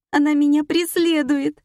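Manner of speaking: high, loud, wailing cry; sobbing. A narrator's reading. Morgunova says her aunt haunts her, acted sobbing. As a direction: wail it out hysterically. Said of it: "рыдала Моргунова".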